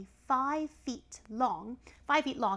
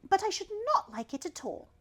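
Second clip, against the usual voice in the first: much higher polite little voice; high child voice; 10 semitones higher, 5 dB louder than usual. Acted. Elephant's child answering the python in a high voice. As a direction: squeak it politely.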